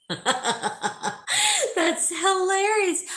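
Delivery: high, slow words broken by laughter; laughing. Real, not acted. Reacting to a funny chat comment.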